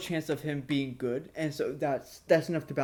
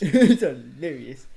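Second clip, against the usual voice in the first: laughing; 2 semitones lower, 9 dB louder, slower than usual. Real, not acted. Laughing repeat of friend.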